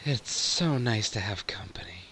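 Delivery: soft, half-whispered to oneself; contented murmur. Acted. Host smiling to herself at having company, soft murmur. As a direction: let it out quietly, with a private smile. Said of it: "She smiles to herself and goes".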